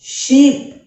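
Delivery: loud ee. Shown as a demonstration. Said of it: "ई की जो ध्वनि है वो थोड़ी सी तेज है".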